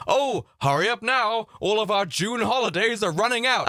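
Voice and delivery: high, urgent mock sales pitch; mock sales email. Holiday company urging customers to book June, mock sales pitch. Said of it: "holiday booking company saying".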